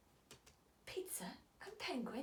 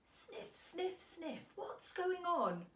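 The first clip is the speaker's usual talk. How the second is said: put-on worried child voice; 9 semitones higher, slower than usual. A narrator's reading.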